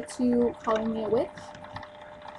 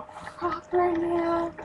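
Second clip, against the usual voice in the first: high, slow, sugary proper talk; mocking her proper talk; mock sweet and innocent; 5 semitones higher, 3 dB quieter, much slower than usual. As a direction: put on a prissy, innocent act, dragged out. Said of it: "she wants to act all".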